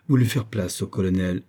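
usual speaking voice

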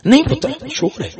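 high, soft protest; small high voice insisting gently; insistent old mother. Acted. Elderly mother contradicting her son, softly insistent.

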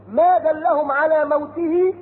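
Quran recitation. Acted. Preacher reciting to the congregation, slow chant. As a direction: draw the words out in chant.

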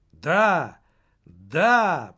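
loud crowd yes; stormy agreement. A narrator's reading. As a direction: shout it back as the crowd. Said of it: "бурно согласился с этим зал".

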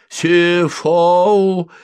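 high, loud cry from a sleeper; drawn-out warning holler; threatening shout. A narrator's reading. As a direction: yell a menacing nonsense word in your sleep. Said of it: "угрожающе вскрикнул спящий".

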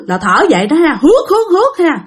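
high, raspy gasps for breath; imitated labored breathing. Imitating the dying man's breathing, high labored gasps. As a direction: wheeze like a man on oxygen. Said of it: "y chang như cái lúc mà anh còn sống".

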